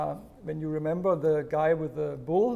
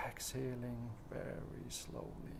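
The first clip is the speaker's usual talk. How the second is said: whispered guidance; 5 semitones lower, 16 dB quieter, slower than usual. Shown as a demonstration. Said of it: "whispered guidance".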